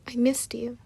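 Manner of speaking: whispered close to her face; tender whisper. Acted. Lovers reunite, he whispers.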